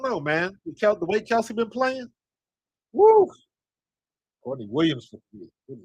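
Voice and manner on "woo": high, loud whooping cheer; a real, delighted woo; excited cheer. Real, not acted. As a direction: whoop for the shot. Hyped about Kelsey's play.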